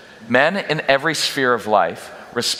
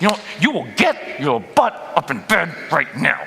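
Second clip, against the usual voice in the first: put-on stern parent hurrying kids to church; firm, pressed parent warning; higher, stern Sunday morning threat; 4 semitones higher than usual. Shown as a demonstration. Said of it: "That is the Sunday morning before church voice".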